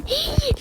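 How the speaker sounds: sharp high startled gasp. Real, not acted. Girl hearing mum coming, startled gasp. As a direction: gasp in sudden fright.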